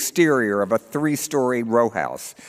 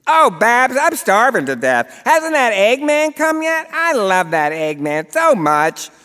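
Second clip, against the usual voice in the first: high, loud, doting whine; whiny old mother; 12 semitones higher, 4 dB louder than usual. Acted. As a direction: gush over the Eggman like a hungry old lady. Edie greets Babs.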